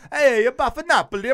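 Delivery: loud, high, hammed-up Italian accent; mock Italian accent. Shown as a demonstration. Co-host showing off his accents, loud mock Italian. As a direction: burst into a cartoonish Italian accent, loudly. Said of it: "I know that's my Italian".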